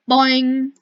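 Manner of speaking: loud springy "boing" sound effect; cartoon boing. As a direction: bounce it out like a spring. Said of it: "we can record this".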